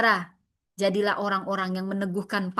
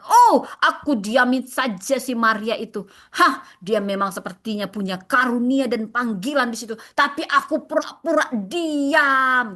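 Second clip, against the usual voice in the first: over-excited acting; 4 dB louder than usual. Acting someone jealous of Maria, over-excited. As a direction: play the scheming rival with too much gusto. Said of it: "Gue kok semangat banget gitu".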